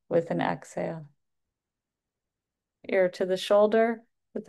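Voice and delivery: unhurried yoga instructor cues with long pauses between; guided breathwork cueing. Acted. Yoga teacher guiding the class through neck stretches, guided breathwork cueing. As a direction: pace each cue to the breath.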